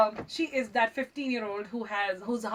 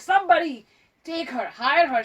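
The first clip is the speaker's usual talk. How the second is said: raised, louder playful appeal; mock impassioned plea; 5 semitones higher, 6 dB louder than usual. Host urging news people to hire guest, mock plea. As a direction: plead her case to the world, half-joking.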